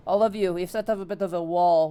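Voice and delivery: loud announcement over the shoulder; addressing the group. Acted. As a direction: raise your voice to those behind you. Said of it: "looks behind and goes".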